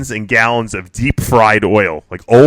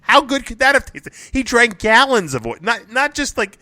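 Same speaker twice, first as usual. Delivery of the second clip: high-pitched, astonished disbelief; incredulous amazement; 8 semitones higher than usual. Real, not acted. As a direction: react in disbelief at an outrageous story. Reacting to absurd news story.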